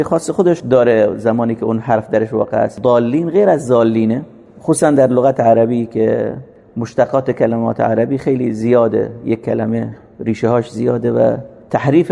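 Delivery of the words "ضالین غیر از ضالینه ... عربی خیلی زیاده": ordinary delivery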